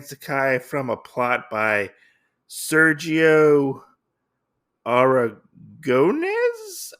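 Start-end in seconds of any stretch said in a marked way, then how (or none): 2.5-6.9 Sergio Aragones, with Aragones drawn out very slowly; careful name. Shown as a demonstration. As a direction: sound out Sergio Aragones syllable by syllable. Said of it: "I think that's how you say it".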